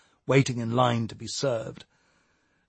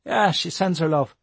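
put-on young Irish lad voice; 6 semitones higher than usual. Matty's voice in story.

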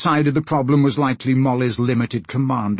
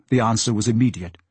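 plain reading voice

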